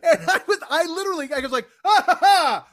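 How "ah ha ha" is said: loud, higher burst of laughter; blurted laugh. Retold or imitated. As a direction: laugh out suddenly. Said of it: "I blurt laughed".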